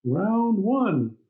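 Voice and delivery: high, drawn-out game announcer call; mock fight announcer. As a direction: announce the bout like an arcade game. Calls the start of a mock fight.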